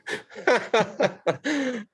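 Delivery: high burst of laughter; real laugh. Real, not acted. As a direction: crack up at being teased. Laughs at teasing about toilet purchase.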